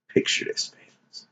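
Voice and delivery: hushed dramatic whisper; a secretive storyteller whisper. Acted. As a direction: draw them in with a whisper. Sets up the scene.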